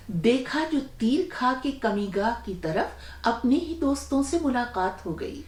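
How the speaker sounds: poetry recitation. Acted. Host quoting a well-known couplet to listeners, poetry recitation. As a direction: recite it as Urdu verse.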